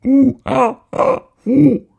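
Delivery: high, loud ooh-ah monkey calls; monkey-like noises. A narrator's reading. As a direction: hoot like a strange creature. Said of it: "making strange monkey-like noises".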